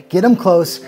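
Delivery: ordinary talk, nothing marked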